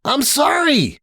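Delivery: high, dramatic cry of apology; exclaiming. Acted. Rainbow exclaiming to Applejack, high dramatic apology. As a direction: throw it out with a hoof to the head. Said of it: "Rainbow exclaimed".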